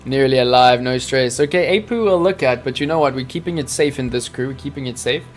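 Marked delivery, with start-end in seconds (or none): none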